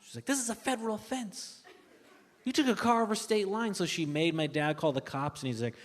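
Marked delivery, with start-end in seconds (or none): 0.2-3.7 higher, hushed mock panic; freaking-out mom. Comic as mom scolding dad over the car, mock freak-out. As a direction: imitate a mother quietly panicking. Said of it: "My mom starts freaking out".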